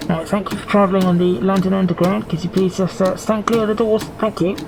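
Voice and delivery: imitation train driver on the tannoy; clipped driver announcement. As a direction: thank passengers like a driver over the PA. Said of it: "Maybe a little bit more clipped".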